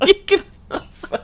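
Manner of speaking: high, laughing repeat; laughing echo. Real, not acted. Echoes with a laugh.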